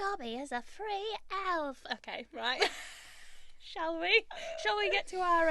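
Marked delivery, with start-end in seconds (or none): 0.0-1.8 high, slow little house-elf voice; drawn-out squeaky elf declaration; small creature voice, pitched way up; Dobby. Acted. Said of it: "Is it like Dobby?". 3.6-5.5 high, squeaky little character; playful high-pitched voice; put-on creature voice. Carries on the creature voice.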